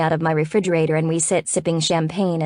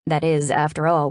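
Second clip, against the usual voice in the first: plain reading voice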